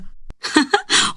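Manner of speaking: high, soft giggle; light acted laugh; amused laugh. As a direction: giggle at a parent's joke. Father's joke gets a laugh.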